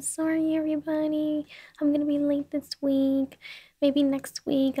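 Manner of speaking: high, singsong fake excuse; mock apology. Acting out excuse to listeners.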